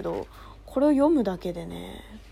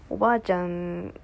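normal talking voice

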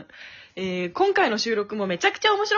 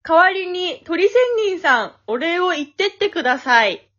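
raised, projected emcee announcement; loud, higher-pitched formal MC patter; mock-formal host; 4 semitones higher, 5 dB louder than usual. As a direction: present the thank-yous with playful ceremony. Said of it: "そういうめんどくさい感じでやるの？".

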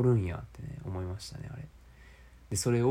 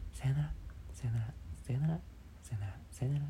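sing-song goodbyes; 4 semitones higher, 6 dB quieter, faster than usual. Shown as a demonstration. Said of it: "綺麗な高い声で".

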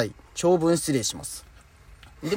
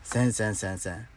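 せんせんせんせん repeated softer, fading and rising at the end; softer afterthought denial; trailing refusal; 6 semitones lower, 9 dB quieter than usual. Real, not acted. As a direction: let the repeated せん die away under the breath. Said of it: "絶対せんよ".

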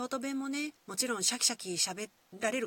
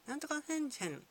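light imitation of punchy German syllables; mock German endings; 7 semitones higher, faster than usual. Shown as a demonstration. Host showing how German bounces vertically, mock German endings. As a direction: toss off made-up German-sounding word endings. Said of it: "フェンで終わる感じがすごく縦の動き".

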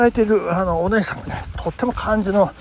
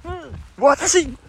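higher, eager waitress voice; excited high reply; 3 dB louder than usual. Retold or imitated. Waitress proudly says she is the best friend, high and eager. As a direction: jump in proudly.

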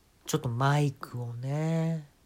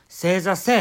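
mock order; 4 semitones higher, 6 dB louder than usual. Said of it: "って言うんです".

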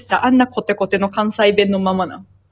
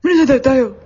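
raised, louder Tokyo-accent mimicry; mock Tokyo speech; 4 semitones higher, 4 dB louder, faster than usual. Teasing co-host over turning Tokyo, mock Tokyo accent. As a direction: put on a Tokyo accent, pitched up. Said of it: "東京五日間おったらなる".

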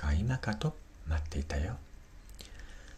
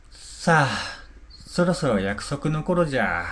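put-on cheerful old man rising up; lively old man, higher; 4 semitones higher than usual. Gisuke getting up to leave. (元気な老人の声)

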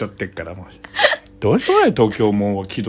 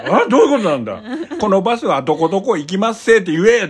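laughing mock outrage; 8 semitones higher than usual. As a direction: laugh while playing baffled outrage. 心の中のツッコミ. (笑いながら高めの声で大げさに憤慨する)

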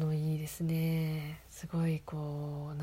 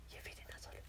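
quiet voice; 14 dB quieter than usual. Real, not acted. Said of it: "最後小声で喋っちゃった".

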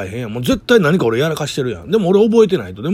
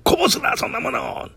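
big brother's retort; 13 semitones higher than usual. Retold or imitated.

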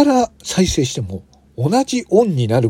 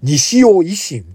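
西尾維新 drawn out high and slow; backwards speech; 6 semitones higher, 3 dB louder, much slower than usual. Shown as a demonstration. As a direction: say 西尾維新 slowly and higher. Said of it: "聞いてみましょう".

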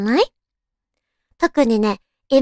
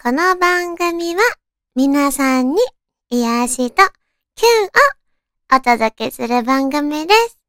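radio show intro; 5 semitones higher, slower than usual. Acted.

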